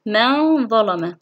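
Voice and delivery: slow من ظلم with held nasal noon; ikhfa demonstration. Shown as a demonstration. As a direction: hum from من into ظلم. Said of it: "في كلمتان". (إخفاء النون بغنّة في من ظلم)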